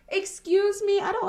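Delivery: shocked outrage; indignant surprise. Real, not acted. Reacting to tiny contribution.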